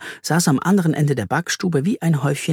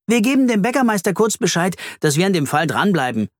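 self-important; 6 semitones higher than usual. A narrator's reading. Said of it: "mit wichtiger Miene".

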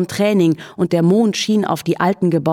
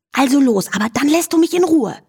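much higher, fast and insistent; lightly voiced young mare laying down terms; demanding Charlotte; 9 semitones higher than usual. A narrator's reading. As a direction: push back at the pest, impatient. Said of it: "verlangte Charlotte".

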